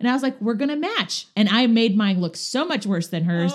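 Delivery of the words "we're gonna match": high, bright retold cheerfulness; playful. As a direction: chirp it like a kid proud of a silly plan. Retelling playful reply to friend.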